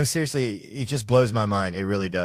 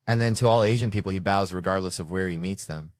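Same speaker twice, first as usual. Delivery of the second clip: ordinary delivery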